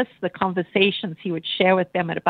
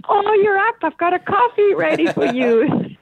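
a much higher, beaming morning greeting; smiling, lifted voice, imitating her husband; cheery husband; 11 semitones higher than usual. Wife imitating husband's cheerful morning wake-up call, higher and beaming. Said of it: "he'd have this big smile on his face".

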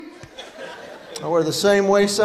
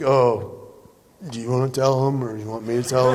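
awkward workers; 9 semitones lower than usual. Retold or imitated. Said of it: "They're like".

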